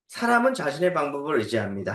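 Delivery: normal talking voice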